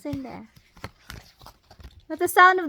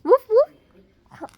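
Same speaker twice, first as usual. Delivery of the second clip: higher, louder playful woof; a higher, louder second woof for the toddler; puppy bark; 7 semitones higher, 6 dB louder than usual. Shown as a demonstration. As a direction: bark it brightly for the child. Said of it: "What's the sound of the dog?".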